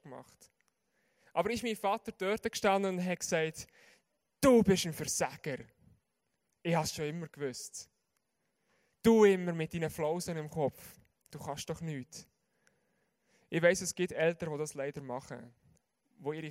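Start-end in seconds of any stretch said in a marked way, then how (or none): 4.4-12.3 scolding father. Retold or imitated. Father's imagined scolding voiced.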